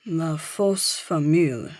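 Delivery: French phrase read out slowly; reading French aloud. A narrator's reading. Michael reads the French headline aloud, slow French words. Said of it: "he reads aloud".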